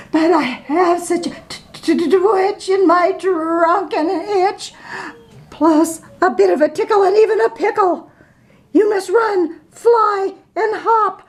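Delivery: high, fast, building up to a sneeze; mounting sneeze panic. Acted. The elephant warns friends, high and hurried. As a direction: rush it as the tickle grows.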